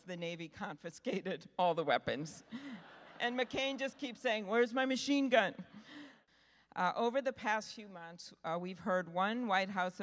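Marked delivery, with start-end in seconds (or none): 4.5-5.5 lightly imitated insistent complaint, louder and higher; mock demand. Senator retold asking for his confiscated weapon, mock demand. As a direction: keep pestering for it, in mock earnest.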